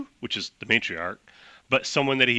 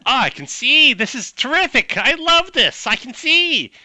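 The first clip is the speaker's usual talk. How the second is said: high, loud, gushing delight; ecstatic translated orc; 6 dB louder than usual. Acted. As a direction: shout it out, overjoyed. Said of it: "is what the translator says".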